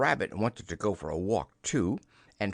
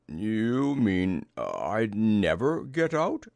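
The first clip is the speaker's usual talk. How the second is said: very slow, dismayed character voice; plaintive storybook bear; 3 dB louder, much slower than usual. Acted. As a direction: draw the question out in disbelief. Pooh fears being stuck forever.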